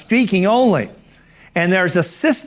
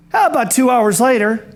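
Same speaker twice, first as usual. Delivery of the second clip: usual speaking voice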